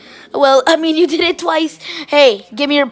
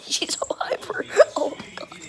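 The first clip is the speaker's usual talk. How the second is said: whisper; 8 dB quieter than usual. Real, not acted. Puppy climbing on her.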